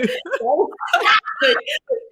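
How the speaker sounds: big high burst of laughter; a real loud laugh. Real, not acted.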